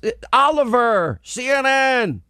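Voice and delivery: mock reporter, slow and high; reporter's reply. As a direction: drag out the letters. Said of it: "He goes".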